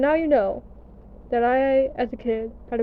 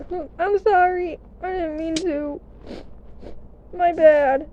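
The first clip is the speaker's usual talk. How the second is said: sheepish apology; 5 semitones higher, slower than usual. Retold or imitated.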